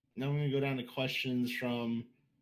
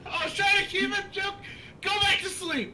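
high-pitched; 4 dB louder than usual. Real, not acted. Joking scold.

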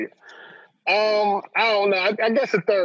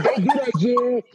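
imitating friend's warning; 6 semitones higher, slower than usual. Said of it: "You gonna".

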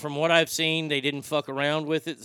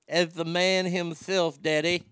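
Dusty Rhodes voice; 2 semitones higher than usual. Retold or imitated. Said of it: "I'm gonna read it as Dusty".

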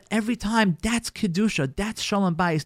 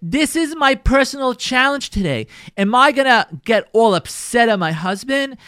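usual speaking voice

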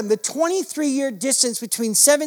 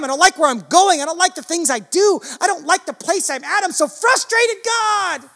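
Jacob's frustrated plea, rising higher; pleading Jacob; 4 semitones higher than usual. Acted. Jacob wrestling the angel, pleading and frustrated. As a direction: cry out to God, voice climbing.

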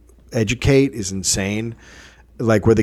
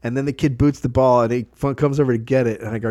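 ordinary talk, nothing marked

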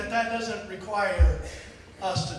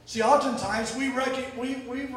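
ordinary talk

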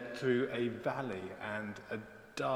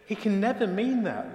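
higher, louder fearful protest; shocked protest; 9 semitones higher, 7 dB louder than usual. A narrator's reading. Frightened deer doubts the shepherd's order, shocked protest. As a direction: act out her shocked disbelief. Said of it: "sick with shock and fear".

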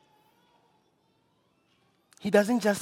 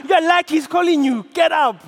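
loud, fast, high cheer; excited urging; 5 semitones higher, 4 dB louder, faster than usual. Acted. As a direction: hurry him up with glee. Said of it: "how it says it in Message Bible".

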